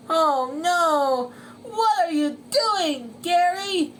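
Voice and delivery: high, loud dismayed shout; SpongeBob shouting. Acted. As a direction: yell in disbelief at your racer. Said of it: "shouted SpongeBob".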